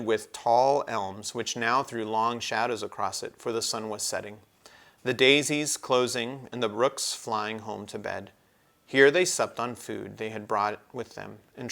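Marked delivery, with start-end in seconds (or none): none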